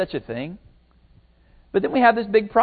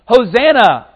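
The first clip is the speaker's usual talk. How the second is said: loud, higher-pitched cry of praise; crowd's acclamation; 6 semitones higher, 9 dB louder, slower than usual. Acted. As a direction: call it out like a cheering crowd. Crowd's acclamation of Jesus acted out.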